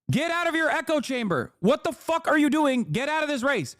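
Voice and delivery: high, heated voicing of a blunt demand; mock angry demand. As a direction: toss it off as a mock furious order. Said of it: "Adam Schiff ... said".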